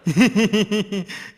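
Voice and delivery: high, amused giggling laugh; high-pitched laughing fit; mocking laugh. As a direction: laugh at the other man's washing. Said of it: "हंस क्यों रहे हो".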